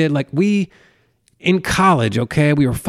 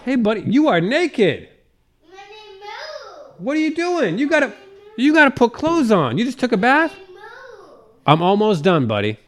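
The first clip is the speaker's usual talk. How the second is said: high sing-song parent voice; much higher, doting daddy voice; 13 semitones higher than usual. Real, not acted. Father talking to his small son.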